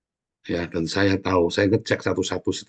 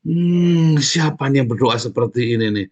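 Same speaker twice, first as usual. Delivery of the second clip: long loud hum, then a raised questioning voice; God's pleased wonder; 4 semitones higher, 5 dB louder than usual. Acted. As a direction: act out God noticing a beloved prayer. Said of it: "itu doa yang paling disayang sama Tuhan". (suara Tuhan yang terkesan, bertanya-tanya)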